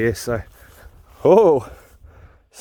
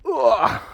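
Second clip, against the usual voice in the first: sharp high ugh of discomfort; pained grunt; 10 semitones higher than usual. Real, not acted. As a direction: wince through the barbed wire. Reaching through barbed wire.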